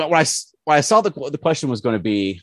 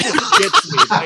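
real laugh breaking through; 5 dB louder, slower than usual. Real, not acted. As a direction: speak while cracking up. Laughing at the memory.

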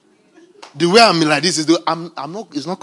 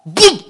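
boom sound effect; 7 semitones higher, 8 dB louder than usual. Acted. Revelation lands.

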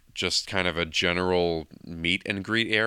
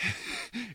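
high laugh; 10 dB quieter than usual. Real, not acted. Reacting to the Woody's Roundup question.

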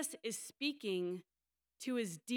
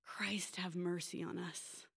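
soft whisper of a plea; whispered prayer; 3 semitones lower, 6 dB quieter than usual. Real, not acted. Preacher sighing a prayer to God, whispered. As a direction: breathe it out as a quiet prayer.

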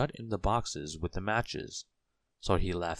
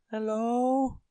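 high, small character voice; tiny Mister Small; 5 dB louder than usual. A narrator's reading. Tiny man wondering how to reach the bell, high voice. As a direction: pitch up high for the little man.

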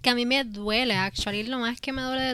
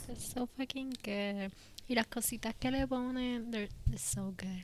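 whispering; 12 dB quieter than usual. Real, not acted. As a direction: whisper it like a guilty craving. Raving about Baskin Robbins cakes.

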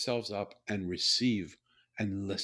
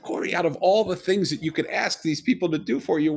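incredulous questioning; 6 semitones higher, 8 dB louder than usual. Retold or imitated. Speaker retells his surprised question.

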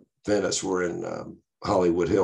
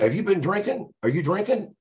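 much higher, repeated pushy questioning; stern cop; 12 semitones higher than usual. Retold or imitated. Cop questions drunk driver, hammed-up pushy interrogation. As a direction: grill him like a suspicious patrolman. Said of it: "He said".

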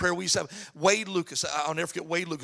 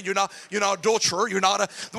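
ordinary delivery

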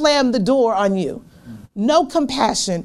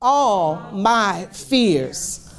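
drawn out and low; slow, weighty repetition; slow, low emphasis; 6 semitones lower, much slower than usual. Acted. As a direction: let each word sink in, slowly. Preacher repeats answer slowly.

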